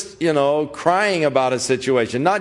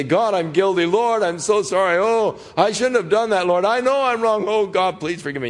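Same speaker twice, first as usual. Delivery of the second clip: higher-pitched, pleading imitation of a crying penitent; imitated crying plea, no real sobs; mock tearful confession; 7 semitones higher, faster than usual. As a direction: act out a guilt-stricken prayer, pitch climbing then easing at the end. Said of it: "if you cry and say".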